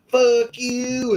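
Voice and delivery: high, loud mock insult; mock angry commenter. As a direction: spit it like an angry troll.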